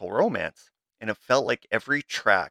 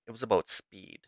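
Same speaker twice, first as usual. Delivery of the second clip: ordinary delivery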